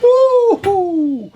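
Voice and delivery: very high whooping cheer. Real, not acted. Machinist celebrating with class, whooping cheer. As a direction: let out a delighted whoop.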